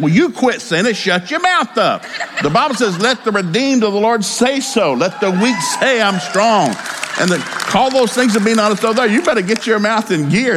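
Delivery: high-pitched, emphatic retort; scolding father. Retold or imitated. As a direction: re-enact a dad's heated telling-off, pitched high.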